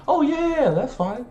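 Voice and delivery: very high, breezy imitated nurse; put-on falsetto agreement; agreeable nurse. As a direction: wave him off in a cheery falsetto. Retold nurse agreeing readily.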